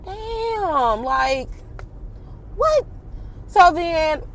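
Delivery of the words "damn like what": stunned disbelief. Retold or imitated. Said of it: "And I'm like".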